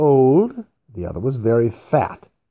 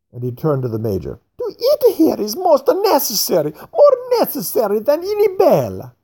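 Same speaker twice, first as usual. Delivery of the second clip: much higher and louder, fuming; angry fat man; 4 dB louder than usual. A narrator's reading. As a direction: lash out at the rich old man. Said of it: "now became quite angry".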